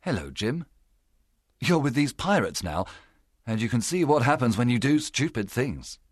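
understated, sorrowful scolding; sad reproach. A narrator's reading. Doctor faces Jim among the pirates, mildly sad. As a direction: reproach the boy gently, with a touch of sadness. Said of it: "The doctor began sadly".

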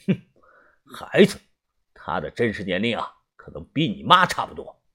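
low, quiet, unhurried character voice; cold gang boss. Acted. Tian Sanjiu scoffing to his doubting men, cold and low. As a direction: play the cold boss, dropping low and soft at the end.

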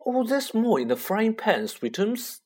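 high, sugary doting voice; doting mother. Acted. Mother coos at her huge son, high doting voice. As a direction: coo at your precious son. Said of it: "said Aunt Petunia".

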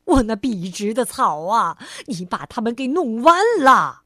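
loud wail of dismay over the grass; anguished cry. Acted. Mr. Fussy crying out over his flattened lawn, loud wail. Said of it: "挑剔先生叫了起来".

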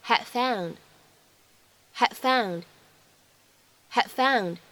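"had found" with D unreleased; incomplete plosion. Shown as a demonstration. Teacher demonstrating incomplete plosion. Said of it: "我们可以读成".

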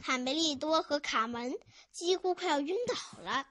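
usual speaking voice

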